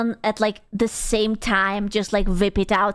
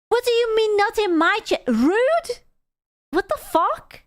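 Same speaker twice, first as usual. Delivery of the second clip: high, affronted squawk; indignant disbelief, much higher voice; mock offended; 9 semitones higher than usual. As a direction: react as if deeply insulted, pitched way up. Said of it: "I'm offended".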